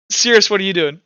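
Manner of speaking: normal talking voice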